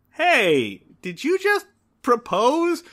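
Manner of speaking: high, slow, incredulous question; half smug, half confused; smug surprise. Retold or imitated. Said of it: "scream propose".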